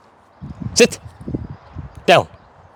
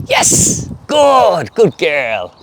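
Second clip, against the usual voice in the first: excited praise; 7 semitones higher, much slower than usual. Real, not acted. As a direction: burst out with praise. Rewarding the trick.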